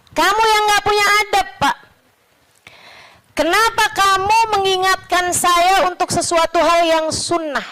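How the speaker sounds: high-pitched mock outrage; retold indignant retort. Sheikh retorts to the man who rebuked him, high acted indignation. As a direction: throw the accusation back, pitched well up.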